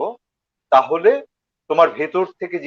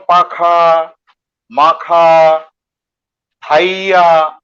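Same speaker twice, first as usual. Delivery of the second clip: slow, louder, stretching পাখা মাখা Thaiya; dragged line ends; 2 semitones higher, 5 dB louder, much slower than usual. Retold or imitated. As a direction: imitate the drawn-out endings. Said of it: "লাইনের শেষে গিয়ে তুমি টেনে দিচ্ছ".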